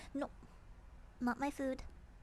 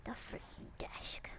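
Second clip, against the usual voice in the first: slow soft whisper; 3 semitones lower, 5 dB quieter, slower than usual. Real, not acted. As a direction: breathe it out, barely voiced. Whispering over the snooping cat.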